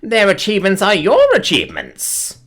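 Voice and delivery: high, grand declaiming; hammed-up praise from the alien; theatrical alien gushing. Alien customer gushing at the cafe owner, theatrical and high.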